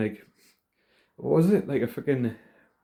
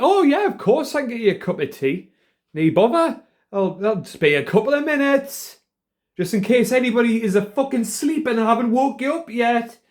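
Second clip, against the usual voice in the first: mocking a loud nurse, much higher and louder; loud nurse impression; 12 semitones higher, 5 dB louder than usual. Imitating the loud hospital nurse, high and loud. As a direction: ham up the booming cheery nurse voice. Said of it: "she just had a really loud voice".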